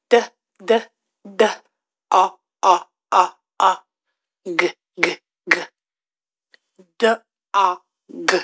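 D, A, G sounds repeated one by one; sounding out letters. Shown as a demonstration. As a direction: break dog into its sounds. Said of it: "a word we're gonna sound out".

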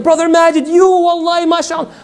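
high-pitched gushing praise; gushing admirer. Acted. An admirer flattering the brother, gushing and high.

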